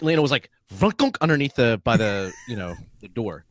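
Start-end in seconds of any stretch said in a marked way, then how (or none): 0.7-1.2 high, punchy "vrunk-unk"; imitated wedging noise. Storyteller forcing a chair into the car, imitated noise. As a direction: mimic a chair leg jamming.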